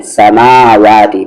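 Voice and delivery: समावाति slowly with a long, stretched मा; correct way. Shown as a demonstration. Teacher gives students the correct stretched मा. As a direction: draw out the मा in समावाति. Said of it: "माँ को भी खींचेंगे".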